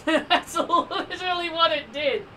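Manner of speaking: high, laugh-filled words; laughing in disbelief. Real, not acted. Gamer thrown a bone by the game, laughing in disbelief.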